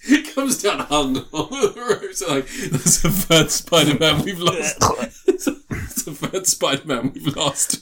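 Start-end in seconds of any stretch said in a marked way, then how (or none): 2.7-7.8 slow line broken up by laughter; laughing. Pretend. Riffing on a drunk Spider-Man musical, laughing helplessly. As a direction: struggle to get the joke out through giggles.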